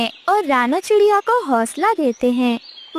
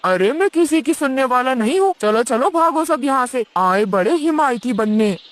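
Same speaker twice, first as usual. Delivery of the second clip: lower, dismissive crow voice; gruff crow; 4 semitones lower than usual. Acted. Crow drives away the birds, lower gruff voice. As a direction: shoo them off, contemptuous and low.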